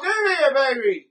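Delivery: loud, high excited call. Real, not acted. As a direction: egg him on. Loud cheer.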